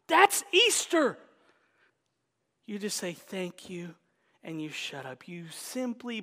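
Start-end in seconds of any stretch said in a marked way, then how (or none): 0.1-1.2 high and loud, bursting with joy; preacher crying out his delight to the congregation; excited exclamation. Real, not acted. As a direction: shout it out in delight.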